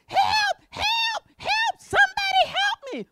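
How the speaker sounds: very high-pitched cry for help; acted screaming. Preacher playing someone who doesn't know the word, screaming. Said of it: "you start screaming".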